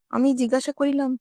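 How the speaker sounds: plain reading voice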